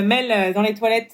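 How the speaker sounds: normal talking voice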